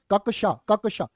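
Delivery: ककशा said slowly as क plus कशा; careful pronunciation. Shown as a demonstration. Teacher drills the class on ककशा. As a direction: sound out ककशा clearly. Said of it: "इसको बोलना अब".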